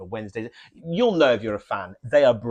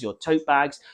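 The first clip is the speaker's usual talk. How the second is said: usual speaking voice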